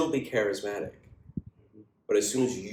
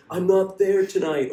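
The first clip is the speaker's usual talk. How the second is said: whiny actor; 11 semitones higher, 8 dB louder than usual. Retold or imitated. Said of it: "Or like".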